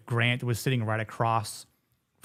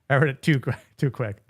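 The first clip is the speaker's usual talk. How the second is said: ordinary talk, nothing marked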